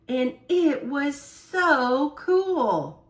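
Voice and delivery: ordinary delivery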